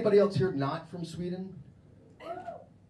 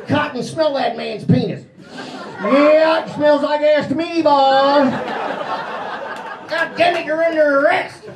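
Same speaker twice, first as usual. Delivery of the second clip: shouted cop order; 13 semitones higher, 15 dB louder than usual. Pretend. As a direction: scream the order through the door. Cops yelling in raid.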